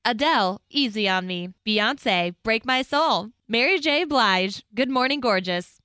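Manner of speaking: projected awards-show nominee read; TV announcer. Shown as a demonstration. Reading nominees to co-host on request, TV announcer voice. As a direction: present each nominee like a Grammys announcer. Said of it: "your T V voice".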